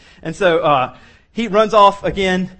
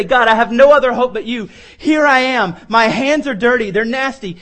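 loud, high pleading cry to God; humble sinner's prayer; 5 semitones higher, 6 dB louder than usual. Acted. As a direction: lift it up as a desperate confession. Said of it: "and we say".

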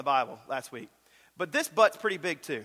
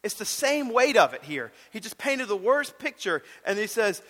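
ordinary talk, nothing marked

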